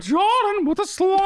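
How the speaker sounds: high catchphrase cracking into laughter; mock exclamation. Berating a caller's video, high laughing catchphrase wail. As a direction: squeal it till the laugh takes over.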